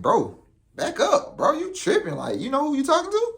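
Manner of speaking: retold squaring up, voice climbing high; confrontational. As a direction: warn him off, rising in pitch. Squaring up to the man.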